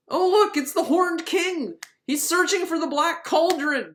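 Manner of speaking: hammed-up breathless discovery; mock excited. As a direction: play up the big reveal. Mocking the vision's revelation.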